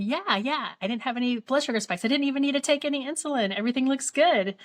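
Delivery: high, eager, quick-running report; proud. Retold or imitated. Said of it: "I was super proud of myself".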